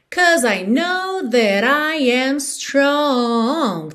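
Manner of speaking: very slow, "strong" held long; STRONG drawn out. Shown as a demonstration. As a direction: stretch "strong" as in the song. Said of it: "essa palavra strong é alongada".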